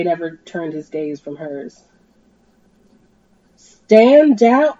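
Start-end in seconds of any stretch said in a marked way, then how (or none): 3.6-4.7 higher, louder rising grunt. Acted. Jay finally orders his crew to stand down, loud. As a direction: force the order out. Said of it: "he finally grunted".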